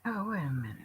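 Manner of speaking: unvoiced whisper. Real, not acted. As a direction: breathe it, no voice. Whispered aside while stepping away.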